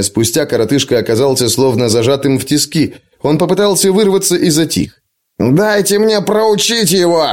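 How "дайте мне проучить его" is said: higher, slow angry growl; growled demand. A narrator's reading. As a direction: growl it out slowly, pitched up. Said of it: "прорычал он".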